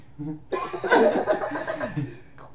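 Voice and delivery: real laughter at the quote; high laugh. Real, not acted. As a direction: crack up. Laughing at the insult.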